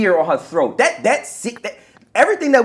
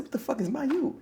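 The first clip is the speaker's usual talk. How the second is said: hushed whisper, much softer; whispered question; 6 semitones higher, 14 dB quieter than usual. Retold or imitated. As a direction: breathe it out in a confused whisper. Retelling his confused reply.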